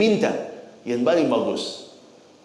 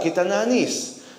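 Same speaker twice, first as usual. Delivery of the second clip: normal talking voice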